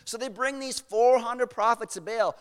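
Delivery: usual speaking voice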